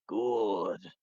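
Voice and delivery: low, quiet villain voice; evil voice. Retold or imitated. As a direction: savour it like a satisfied villain. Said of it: "Puts on a little evil voice".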